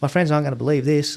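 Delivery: lightly voiced girl gushing in happy disbelief; excited squeal. Retelling the girl's reaction to his return, mock excited. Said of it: "she goes".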